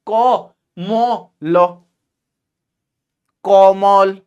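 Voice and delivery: Bihari कोमल with rounded 'o' in both syllables; Bihar tone. Shown as a demonstration. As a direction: spell को, मो, लो, then join it in a Bihari accent. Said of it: "भाई यही कहते हो ना तुम इसे".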